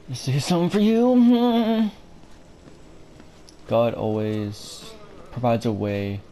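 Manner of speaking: slow eerie mock preacher; creepy slow voice. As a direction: drawl it out like a madman. Mocking the crazy guy.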